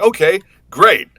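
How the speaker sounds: high, loud, slow fake enthusiasm; mock chipper reply. Actor imitating himself accepting an odd role, hammed-up brightness. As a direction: sell it like a forced cheerful yes. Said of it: "they'll just be like".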